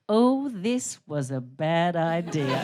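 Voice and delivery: slow, low groan of regret; mid-cigarette regret. Acted. Comic halfway through a cigarette, slow regretful groan. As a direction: drag it out, queasy and regretful. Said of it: "the middle of the story is".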